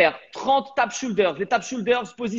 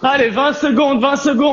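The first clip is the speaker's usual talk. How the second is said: raised, higher coach call; rally the class on the clock; calling out time; 5 semitones higher, 6 dB louder than usual. Real, not acted. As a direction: coach shouting the countdown to his viewers.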